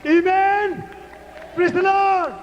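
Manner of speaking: high, loud acclamation; praise shout. Real, not acted. Preacher to congregation, rousing high shout. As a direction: belt it out to rouse the crowd.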